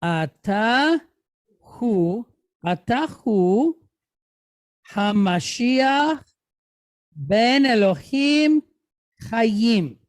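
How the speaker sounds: slow, word-by-word Hebrew reading; measured model reading for students to follow; reading aloud for learners. Shown as a demonstration. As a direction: read it out slowly, pausing between phrases. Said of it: "let's do it one more time".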